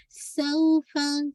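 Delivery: सौफा with a sharp whistled स and long glide; clear whistling S. Shown as a demonstration. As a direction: stretch सौफा slowly, hissing the स clearly. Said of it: "whistling वाज़े हो". (सौफा में सीटी जैसी स, धीरे खींचकर)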